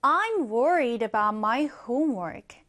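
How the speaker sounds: very slow, clear English sentence; slow teacher's reading; slow example read. Shown as a demonstration. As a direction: read it word by word for learners. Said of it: "예문을 한번 볼게요".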